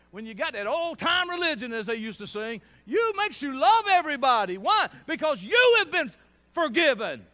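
loud, rising preacher call; full-force preaching. Real, not acted. Preacher calling out to the congregation at full force. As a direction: preach it out to the room.

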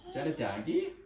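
ordinary delivery, nothing marked

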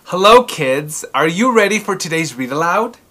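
usual speaking voice

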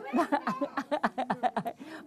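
real chuckle, in a run of short bursts; laughing. Real, not acted. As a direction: laugh lightly for a couple of seconds. Laughs while talking to children.

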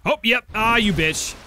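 loud startled curse; startled shout. Real, not acted. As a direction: shout in sudden alarm. Attacked by creature.

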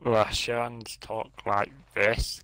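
very low, slow Russian accent; ponderous mock-Russian accent; bad Russian accent. Shown as a demonstration. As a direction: do a hammy, deep Russian voice. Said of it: "[name], do your Russian accent".

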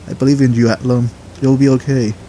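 ordinary talk

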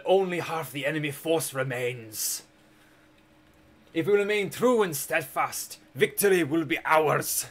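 slow, raspy dwarf war announcer. Retold or imitated. Gamer imitating the dwarf announcer from Third Age Total War. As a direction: rasp it out like a battle herald rallying troops. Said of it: "dwarf announcer".

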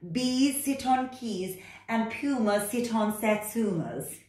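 loud, high, bossy cat voice. Acted. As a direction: order the frog about like a know-it-all cat. Cat character.

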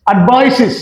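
অ্যাডভাইসেস ending in "-siz", falling; new "-iz" ending. Shown as a demonstration. Teacher showing the corrected way, অ্যাডভাইসেস with "-siz". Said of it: "এখন আমরা বলবো".

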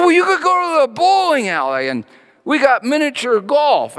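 upbeat small-town pastor; quoting the pastor. Retold or imitated. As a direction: sell the town's attractions brightly.

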